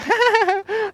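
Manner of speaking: a quick high burst of laughter. Real, not acted. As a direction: laugh out in relief. Laugh after the near miss.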